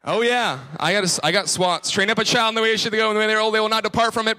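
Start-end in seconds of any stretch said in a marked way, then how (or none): 1.9-4.4 fast, higher, run-on verse; rattled-off recitation. Acted. Preacher recites the verse after his spanking, rapid-fire. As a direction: reel it off by rote without a break.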